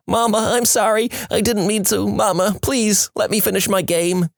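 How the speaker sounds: hammed-up tearful little kid; crying kid. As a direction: imitate a boy begging his mama through tears. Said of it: "he's crying and saying".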